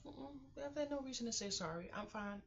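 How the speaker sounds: high protest. Retold or imitated. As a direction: toss it off high and fast. Quoting her reply to doctor.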